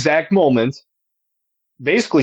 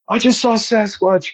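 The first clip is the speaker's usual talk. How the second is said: high, breathless blurting; out of breath; 8 semitones higher than usual. Retold or imitated. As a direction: gasp it out after a run. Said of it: "I'm all out of breath".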